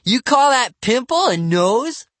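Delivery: high, loud, drawn-out jeer; mocking cry. A narrator's reading. Bradley insults Livy's nose, slow loud mockery. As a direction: taunt her slowly and loudly. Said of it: "he cried".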